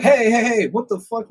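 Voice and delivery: high, loud alarmed "hey hey hey"; startled shout. Retold or imitated. Hobos shouting at the skater who pulled a knife, startled. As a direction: back off in sudden alarm.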